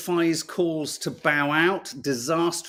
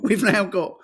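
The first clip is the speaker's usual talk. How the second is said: usual speaking voice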